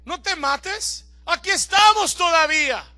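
slow, loud urgent call of Pablo. Acted. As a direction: plead with the jailer to stay. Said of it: "Pablo le dice". (Pablo grita despacio al carcelero que no se mate)

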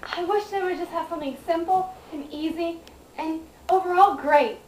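usual speaking voice

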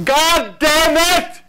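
slow, high full-force scream; yelling in frustration. Retold or imitated. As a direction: imitate the neighbour screaming at her trash. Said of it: "I'm gonna yell".

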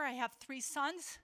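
normal talking voice

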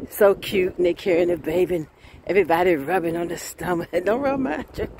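ordinary talk, nothing marked

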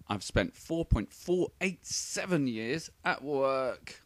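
ordinary delivery

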